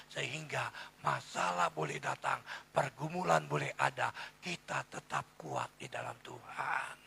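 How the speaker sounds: preacher's voice swelling to a loud climax; building preaching. Real, not acted. Preacher urges congregation to stand strong in God, building preaching.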